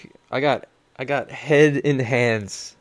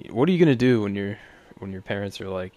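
ordinary delivery

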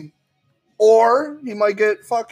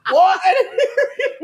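high-pitched comic cry of not hearing; mock hard of hearing; 12 semitones higher, 3 dB louder than usual. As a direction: call out high, on the edge of laughter. Plays victim missing an ear.

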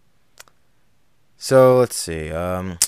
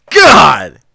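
loud, high cry of exasperation; exasperated outburst; 5 semitones higher, 9 dB louder than usual. Real, not acted. Host reacts to bad film dialogue, exasperated outburst. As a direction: burst out loudly in disbelief.

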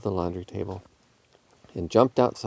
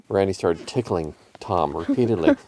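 ordinary delivery, nothing marked

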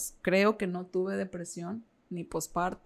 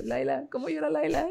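put-on high, teasing sing-song to a toddler; playful baby talk; 8 semitones higher than usual. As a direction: coo at the little girl like mocking her crying. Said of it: "yo arremedaba a Laila cuando lloraba". (voz juguetona de mamá imitando a una bebé)

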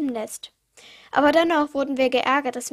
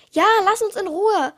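higher, louder annoyed protest; emphatic backing-up; 6 semitones higher, 6 dB louder than usual. A narrator's reading. Young raven backing up the brother, emphatic and annoyed. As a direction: insist loudly on being left alone. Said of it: "bekräftigte ich".

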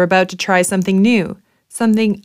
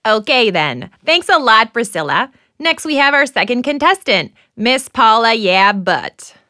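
game show host. Acted.

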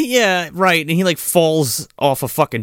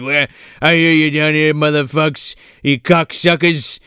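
mock drunk Sarge growl; 3 semitones higher, 3 dB louder than usual. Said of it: "he's like".

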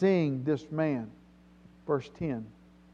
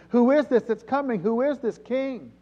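high, raised questioning voice; crowd's urgent question; 7 semitones higher, 4 dB louder than usual. Acted. Preacher voicing streets and temple asking, high and urgent. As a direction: ask it like an astir crowd.

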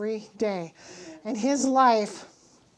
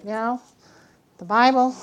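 ordinary talk

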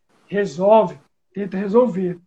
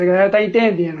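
normal talking voice

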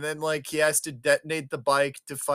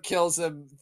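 ordinary delivery

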